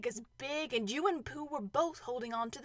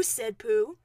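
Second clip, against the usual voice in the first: usual speaking voice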